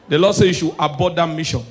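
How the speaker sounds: ordinary talk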